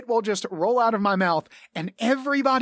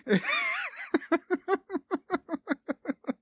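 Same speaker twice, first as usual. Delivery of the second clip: shouted high laugh. Real, not acted. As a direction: cackle with delight at fooling them. Laughing at players assuming plan.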